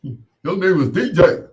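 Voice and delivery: high, loud mocking imitation; mock-proud caricature, high and loud; loud mock proud quote. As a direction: shout it like a proud know-it-all. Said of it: "like they're proud".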